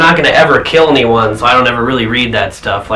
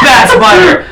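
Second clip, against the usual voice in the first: high-pitched yell at a spider; comically outraged high shout; mock scolding shout; 3 dB louder, slower than usual. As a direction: yelp it like scolding a naughty pet. Acting Deadpool scolding the spider.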